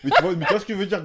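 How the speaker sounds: laughing. Real, not acted. Laughing while making his point.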